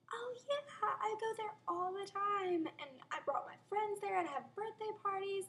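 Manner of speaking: high, put-on gushing; bluffing enthusiasm. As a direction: gush as if you know it well. Bluffing about restaurant in retold conversation.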